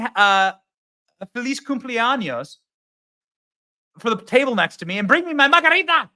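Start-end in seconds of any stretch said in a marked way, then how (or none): 1.3-6.1 mock Kamala. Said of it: "accent switch Kamala".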